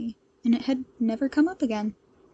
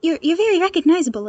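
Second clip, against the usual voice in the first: fast, stumbling correction; hasty backtrack; 6 semitones higher, 7 dB louder, faster than usual. Acted. Nervous boy backtracking to Shoto, hasty and fast. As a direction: rush to fix it. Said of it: "the boy hastily backtracked".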